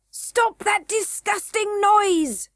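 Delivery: high, slow, bold goblin prince; valiant cry. A narrator's reading. Crown prince threatening Curdie, slow and high. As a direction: puff up and command. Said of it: "cried the crown prince valiantly".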